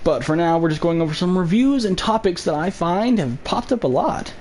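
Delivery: ordinary talk, nothing marked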